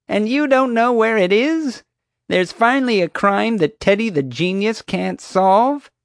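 very high, loud gloating voice; gleeful mockery. A narrator's reading. Guard mocking the boy over the missing house, gleeful. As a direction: crow over it, hugely amused. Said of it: "Marge burst into laughter".

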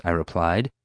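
plain reading voice

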